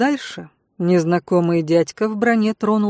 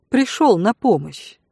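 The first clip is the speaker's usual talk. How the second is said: plain reading voice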